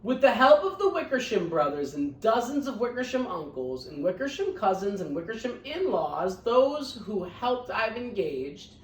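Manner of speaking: lively read-aloud storytelling; picture book reading voice; story narration. A narrator's reading. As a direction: read it to the class with bounce.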